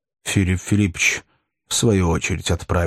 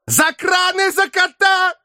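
sudden high yell; barked shout; 3 dB louder, slower than usual. A narrator's reading. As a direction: snap out of irony and bark. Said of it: "рявкнул вдруг".

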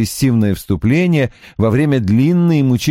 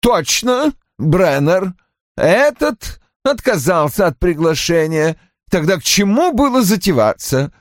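high-pitched, complaining character voice; peevish man recalling a ruined day; querulous husband; 9 semitones higher than usual. A narrator's reading. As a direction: pitch it up and grumble about the disaster. Narrator voices Roy complaining.

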